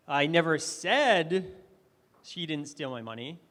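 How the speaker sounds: "said" hit loud and drawn out, pause after it; stress on SAID. Shown as a demonstration. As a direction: punch "said", pause, drop the rest. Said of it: "I emphasized different words".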